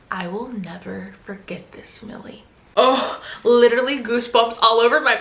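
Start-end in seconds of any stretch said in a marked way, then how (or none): 0.1-2.4 whispered slow threat, far softer; wife to housekeeper, whispered threat; menacing whisper. Retold or imitated. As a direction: breathe the threat right in her ear. Parting threat leaned close.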